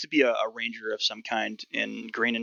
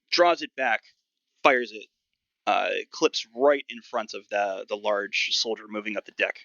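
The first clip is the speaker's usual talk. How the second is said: ordinary talk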